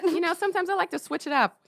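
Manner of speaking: high, fast playful tone. Real, not acted. Playful sing-song reply.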